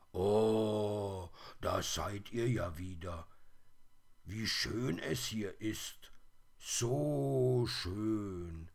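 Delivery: long drawn-out cry of wonder; awed, happy exclamation; delighted calling out. A narrator's reading. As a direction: marvel like a little bear, stretching the vowels. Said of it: "rief er".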